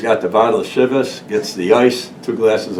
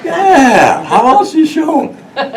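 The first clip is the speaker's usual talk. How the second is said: tipsy boss; 6 dB louder than usual. Retold or imitated. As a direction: play the merry drunk boss, pitched way up. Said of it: "he goes".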